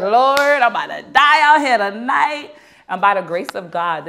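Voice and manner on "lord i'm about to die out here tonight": high, loud wail to heaven; mock panic. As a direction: cry out in hammed-up despair. Stranded with raging man, window closes.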